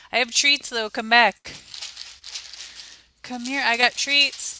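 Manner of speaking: high, loud call to a wandering cat. Real, not acted. Owner calling her cat back for treats, high and loud.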